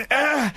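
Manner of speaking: high, strained swinging "ah"; mimed effort groan. Retold or imitated. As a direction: grunt as if pumping a swing with your legs. Mimics pumping legs on the swing.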